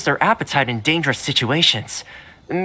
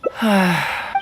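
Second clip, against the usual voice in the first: audible let-down sigh; resigned sigh; 4 semitones higher than usual. Acted. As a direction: sigh as the coaxing fails. Dog refuses the food.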